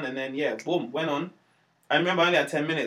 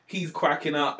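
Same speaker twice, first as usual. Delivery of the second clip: ordinary talk, nothing marked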